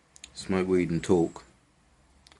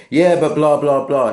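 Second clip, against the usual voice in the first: higher, louder whiny protest; mock arguing punter; 8 semitones higher, 6 dB louder than usual. Punter argues back with the guard, hammed-up whine. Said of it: "bit of role play there".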